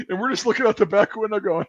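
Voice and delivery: ordinary delivery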